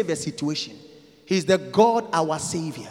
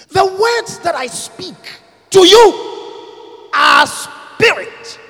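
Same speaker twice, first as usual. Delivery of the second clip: slow, loud, shouted scripture; thundering quotation; preaching at full force; 9 semitones higher, 9 dB louder, much slower than usual. Acted. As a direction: proclaim the verse loudly.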